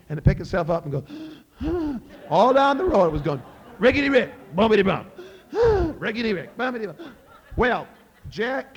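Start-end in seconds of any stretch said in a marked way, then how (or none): 1.6-2.2 high sharp wheezing gasp; horse gasp. Acted. Nag wheezing as it staggers down the road, high gasp. 3.8-7.1 loud sing-song clatter with high gasps; knocking horse rhythm. Acted. Old nag stumbling down the road, chanted hoofbeat clatter. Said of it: "its knees would knock together".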